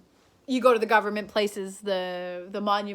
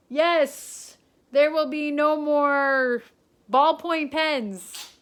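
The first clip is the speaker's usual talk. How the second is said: slow, loud, raised proclamation; mock prime minister's decree; 7 semitones higher, 7 dB louder, much slower than usual. Prime minister putting out a silly rule, slow loud mock decree. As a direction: proclaim it grandly, drawing out the words. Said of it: "if he was to, like, put out a rule and be like".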